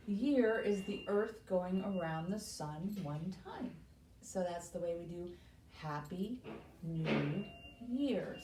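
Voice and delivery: ordinary talk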